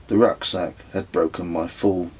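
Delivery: ordinary delivery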